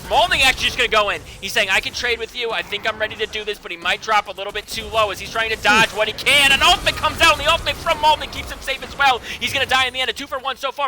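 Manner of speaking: loud, high caster calling the fight; hype play-by-play. Real, not acted. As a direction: yell the ultimates as they land. Hype casting of a teamfight.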